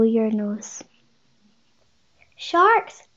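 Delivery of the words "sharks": high, loud cry of alarm. A narrator's reading. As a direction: call out the danger, high and loud. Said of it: "cried Rose".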